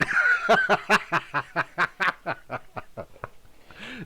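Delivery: long, high-pitched laughing fit; real cracking up. Real, not acted. Streamer after joke about letters, cracking up. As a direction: laugh on and on at the punchline.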